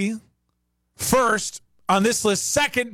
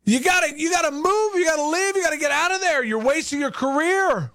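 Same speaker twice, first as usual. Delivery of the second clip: higher, pleading mock outrage; mock NBA pundit; 7 semitones higher than usual. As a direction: urge the star to leave, mock drama. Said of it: "it'd be like".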